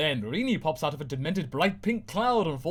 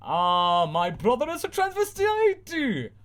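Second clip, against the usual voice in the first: high, slow, horrified exclamation; shocked gasp; 11 semitones higher, 5 dB louder, slower than usual. Acted. Sister shocked at her brother's costume, high and slow. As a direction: recoil in mock horror.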